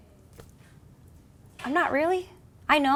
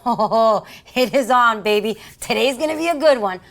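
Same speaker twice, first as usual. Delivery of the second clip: loud, relishing retold self-talk; gung-ho; 2 semitones higher, 8 dB louder than usual. Officer psyching herself up for the fight, gung-ho. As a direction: chuckle into it, cocky and eager.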